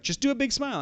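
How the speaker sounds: high-pitched mock photographer voice; Marius. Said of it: "And he's like".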